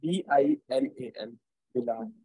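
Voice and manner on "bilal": ordinary talk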